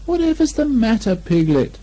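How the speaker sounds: Christopher Robin asking. A narrator's reading. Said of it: "said Christopher Robin".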